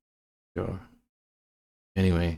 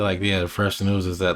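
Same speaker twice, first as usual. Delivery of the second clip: ordinary talk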